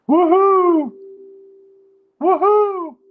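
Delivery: piercing high, loud woohoo answering back; ukulele woohoo. Acted. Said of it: "the ukulele answered me".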